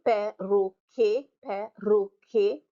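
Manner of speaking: perroquet slowly as pe-rro-quet; French perroquet, syllable by syllable, lifting at the end; three syllables. Shown as a demonstration. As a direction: stretch perroquet into three separate beats. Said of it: "Definitely three syllables there".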